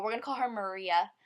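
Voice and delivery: ordinary talk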